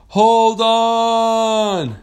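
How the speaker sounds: high, loud, long-held cry; desperate yell. Acted. Rider yelling to the speeding whale, drawn-out holler. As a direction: holler it out, stretching both words.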